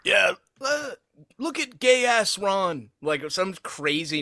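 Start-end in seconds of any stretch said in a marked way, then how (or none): 0.1-2.9 high, slow mocking Trump voice; Trump impression. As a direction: jeer it like Trump. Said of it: "ready for him to whip out".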